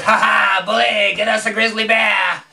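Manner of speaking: hearty, excited presidential shout; excited Teddy Roosevelt. Acted. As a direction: call out with gleeful, hammed-up gusto. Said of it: "he was pretty excited".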